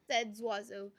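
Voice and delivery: plain reading voice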